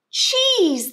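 put-on hungry children screaming at their sister. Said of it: "they screamed".

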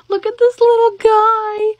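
high, loud, slow coo; drawn-out adoring squeal; delighted gushing. Real, not acted. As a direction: gush over something tiny and cute. Gushing over a cute new plush.

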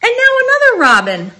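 quick, rising storyteller excitement; excited. A narrator's reading. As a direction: rush the line with delight. Excited narration of birds.

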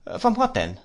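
surprised question. A narrator's reading. Trotwood startled by her answer.

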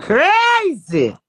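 "Crazy!" called out high and loud; loud exclamation. Real, not acted. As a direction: belt it out, falling at the end. Exclaims after long pause.

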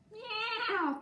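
very high mewing meow; cat meow. Acted. Teacher voicing the cat for kindergarten, very high meow. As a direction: mew it like a little kitten. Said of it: "cried the cat".

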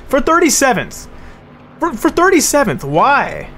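high-pitched indignant complaint; real exasperation; exasperated whine. Real, not acted. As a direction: whine at the pointlessness. Complaining about rival wreck.

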